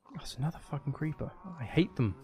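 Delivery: ordinary talk, nothing marked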